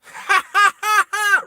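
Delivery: high mocking ha ha; mock villain laugh. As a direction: cackle it like a gloating villain. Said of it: "she goes".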